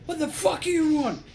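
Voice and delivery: high, loud mock outrage at the door; retold yell. Mate Tim yelling at the Jehovah's Witnesses at the door, loud mock outrage. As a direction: bellow it like a startled roommate.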